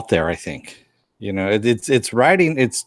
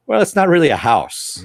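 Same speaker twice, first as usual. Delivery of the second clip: wry Cassidy; 7 semitones higher, 4 dB louder than usual. Retold or imitated. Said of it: "And he goes".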